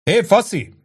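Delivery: hollering for the waiter; shout. A narrator's reading. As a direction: yell over the bar noise. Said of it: "kiáltott Angelo a pincérnek".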